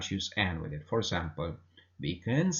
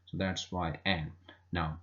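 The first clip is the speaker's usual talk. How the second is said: ordinary talk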